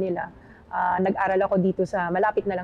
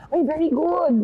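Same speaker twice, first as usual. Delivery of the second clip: higher, louder professor praise; 8 semitones higher, 4 dB louder than usual. Retold or imitated. Guest imitates professor praising her, higher and louder. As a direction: play the impressed professor. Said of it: "sasabihin ng professor ko".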